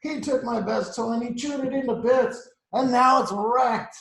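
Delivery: high, loud upset complaint; hammed-up wronged little girl; crying out. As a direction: wail it, dragging out the end. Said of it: "cried Olivia".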